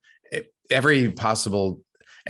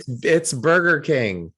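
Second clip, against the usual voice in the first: higher, slow, emphatic protest; exasperated, retold; 6 semitones higher, 4 dB louder, slower than usual. Customer to baffled Burger King worker, retold exasperation. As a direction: spell out the obvious, slowly and higher.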